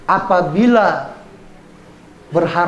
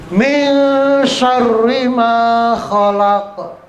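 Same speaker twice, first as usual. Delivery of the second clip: higher, slow drawn-out recitation; model Quran recitation; 5 semitones higher, 4 dB louder, much slower than usual. Shown as a demonstration. Teacher reciting a verse for pupils, slow measured chant. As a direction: recite with long held nasal and doubled r.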